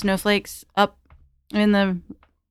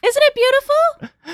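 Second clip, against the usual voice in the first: high, slow sing-song coo; sweet high voice; 4 dB louder, slower than usual. Acted. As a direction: coo it like talking to a child. Player voicing delight to girl.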